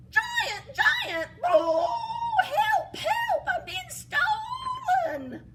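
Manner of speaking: very high, shrieking cries for help; a stolen harp wailing for the giant; screaming harp. Acted. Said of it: "the harp started going".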